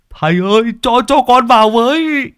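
put-on high, pleading voice of a panicked young disciple. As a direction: beg your master to let you in, voice pitched well up. Flustered disciple begs to get in. (giọng đệ tử cao vút, van nài)

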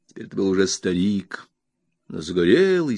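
ordinary delivery

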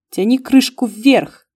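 firm, slow command. Acted. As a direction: order him to pull. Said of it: "скомандовала я". (властный приказ)

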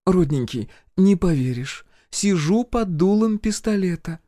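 high-pitched, breezy confiding; smiling sweetness. A narrator's reading. Healer reports the gun to her friend, smiling. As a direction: tell it with a smile in the voice. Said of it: "продолжала улыбаться Надежда Владимировна".

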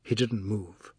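ordinary delivery, nothing marked

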